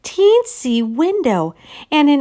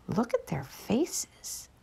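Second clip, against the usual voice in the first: whisper; 15 dB quieter than usual. Real, not acted. Reader whispering to the child listening, hushed. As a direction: lean in and whisper it. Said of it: "reader whispers to listener".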